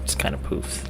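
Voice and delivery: soft unvoiced repeat of the line; a hushed echo of a player's words; whispered echo. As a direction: breathe it back, amused. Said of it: "Love that".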